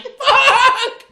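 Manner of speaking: very high, loud comic scream; hammed-up shriek of panic; terrified scream. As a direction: scream it like the van is tipping over. Reenacting terror as van jerks.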